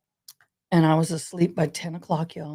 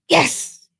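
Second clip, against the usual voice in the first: excited yes; 9 semitones higher, 6 dB louder than usual. Retold or imitated. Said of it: "I went".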